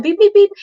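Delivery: short burst of high, loud beeps; imitated monitor beeping. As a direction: mimic an alarm on a hospital monitor. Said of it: "all I hear is beep".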